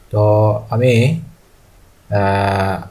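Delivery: ordinary talk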